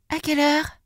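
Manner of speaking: high piglet question; little pig. Acted. Little pig asks the wolf for a time, high-voiced.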